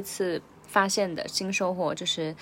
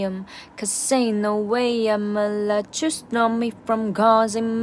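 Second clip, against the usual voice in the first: slowed-down rap, louder, words drawn out; slow version; 3 dB louder, slower than usual. Shown as a demonstration. Language teacher giving the slow version of the verse, slow. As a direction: rap it slowly and clearly for learners. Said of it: "接下来是慢速版".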